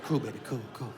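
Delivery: low, drowsy stoner murmur; lazy and dreamy like a stoned father; stoned dad. Retold or imitated. Stoned dad voiced in story.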